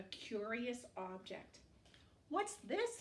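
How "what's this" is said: high, bright-eyed penguin asking. A narrator's reading. Reading to kids, Penguin finds a strange object, high curious voice. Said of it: "he says".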